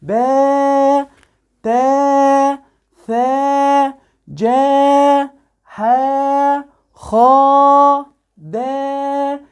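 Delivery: باء، تاء، ثاء each with a very long alif, on a high held note; stretched letters. Shown as a demonstration. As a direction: slowly chant each letter from باء to دال, holding its vowel long. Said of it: "نشد الحرف عشان كبره بقى طويل خالص". (مدّ الحروف من باء إلى دال بنغمة طويلة عالية)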